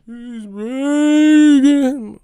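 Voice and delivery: very high, slow, loud ghostly wail. Retold or imitated. Mimicking Bradley like a ghost, very high slow wail. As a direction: moan it like a ghost. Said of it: "sound like a ghost".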